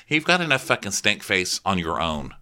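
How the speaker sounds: ordinary talk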